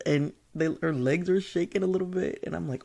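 ordinary delivery

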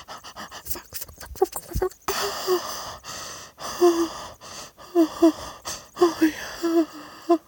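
breathless rising outcry; climax cries. Acted. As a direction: let it climb to a peak. Peak of the scene.

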